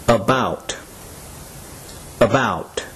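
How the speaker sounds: About, falling on a stressed second syllable. Shown as a demonstration. Teacher repeats About for learners, stressing the second syllable. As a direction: say About with weight on "bout". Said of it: "second syllable that is stressed".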